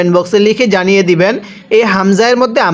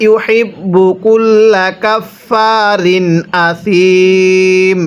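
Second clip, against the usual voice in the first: slow, drawn-out tajweed chanting; measured Quran recitation for students; much slower than usual. Shown as a demonstration. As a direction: recite each word slowly with long vowels. Teacher recites the verse.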